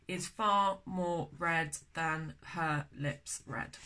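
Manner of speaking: slow, measured beat-by-beat verse; slow scansion reading. Shown as a demonstration. Teacher testing the stresses of the next line, slow scansion. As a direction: pick out each syllable slowly.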